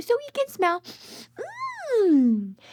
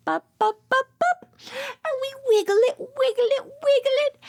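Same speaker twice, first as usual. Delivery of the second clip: high, fast, louder "wiggle it"; bouncy playful trunk wiggle; high playful chant for toddlers; excited; 8 semitones higher, 3 dB louder, faster than usual. Acted. Acting out the elephant trunk.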